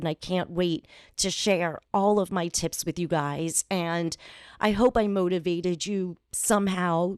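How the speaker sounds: ordinary talk, nothing marked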